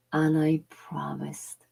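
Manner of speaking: a whispered, unhurried closing vow; soft promise. Real, not acted. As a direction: lean in and promise softly.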